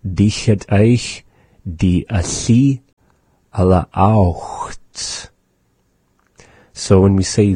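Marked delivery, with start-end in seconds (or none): 0.0-5.3 "Dich het eich die asi alle aucht" spoken slowly word by word; careful slow teacher demo; slow model phrase. Shown as a demonstration. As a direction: lay out the phrase slowly for learners. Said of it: "is it the one around there?".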